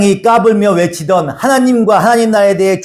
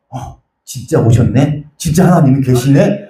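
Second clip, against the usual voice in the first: awed disbelief; 5 semitones lower than usual. Retold or imitated. The scoffers suddenly facing God, stunned and hushed. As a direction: gasp it out as if God just appeared. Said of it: "이러면서 말도 못해".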